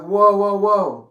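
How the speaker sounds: retold stunned protest, loud and high; drawn-out, startled whoas; taken aback. Retold shocked reaction.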